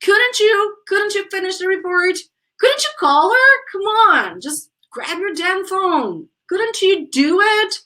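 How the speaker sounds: high-pitched, nagging negative questions; demonstrative high-voiced reproach; exasperated intonation. Shown as a demonstration. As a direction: push them impatiently, pitched high. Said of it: "Look at the intonation. Just check it out".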